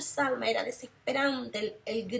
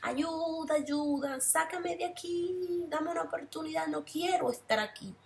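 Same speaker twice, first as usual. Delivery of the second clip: higher pleading voice of burning souls; mock wail for help, higher pitch; imitating desperate cries; 6 semitones higher than usual. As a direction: plead like someone trapped in fire, understated. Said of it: "se quemaban las personas y gritaban".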